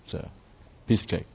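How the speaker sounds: normal talking voice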